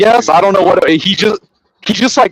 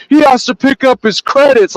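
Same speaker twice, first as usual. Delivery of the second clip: high, insistent mock protest; retold indignant objection; stubborn clerk; 11 semitones higher, 3 dB louder than usual. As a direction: imitate someone arguing without listening, pitch way up. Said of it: "he's just not listening".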